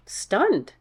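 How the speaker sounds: ordinary delivery, nothing marked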